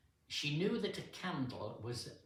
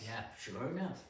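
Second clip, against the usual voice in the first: ordinary delivery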